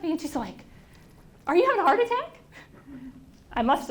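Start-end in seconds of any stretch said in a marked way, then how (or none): 1.5-2.4 much higher and louder, worried passerby; a startled woman rushing up to a stranger; woman on the corner fearing a heart attack, alarmed. Retold or imitated. Stranger asks on street corner.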